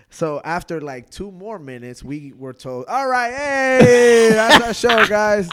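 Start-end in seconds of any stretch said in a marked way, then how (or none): 2.9-5.5 loud, high, long drawn-out "hey"; hammed-up cheer to close the show; show sign-off. Acting the hurried show sign-off.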